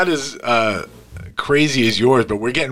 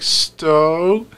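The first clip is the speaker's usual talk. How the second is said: timid imitation; 5 semitones higher than usual. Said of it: "She's like".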